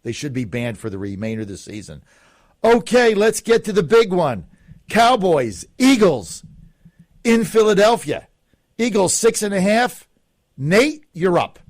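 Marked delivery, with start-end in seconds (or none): 2.6-11.6 loud, high announcer build-up; hyped show host. Acted. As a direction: sell the big game like a host. Host announces big matchup.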